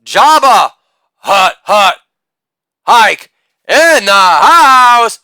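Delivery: family yelling a nickname; yelled out. Acted. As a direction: yell it like a stadium chant. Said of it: "yell out".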